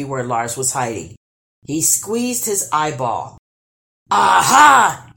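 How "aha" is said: very loud, raised-pitch troll roar; triumphant roar. A narrator's reading. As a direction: roar out your discovery. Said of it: "he roared".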